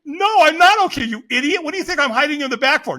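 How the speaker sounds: loud, high, indignant comeback; exasperated retort. Retold or imitated. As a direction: snap back, loud and fast by the end. Unspoken angry retort to stranger.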